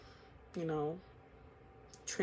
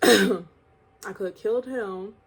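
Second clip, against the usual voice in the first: louder and slow, each word landed; emphatic realization; 11 dB louder, slower than usual. Real, not acted. Telling viewers he could have killed someone, loud and slow. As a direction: hammer home how bad it could have been. Stressing how dangerous it was.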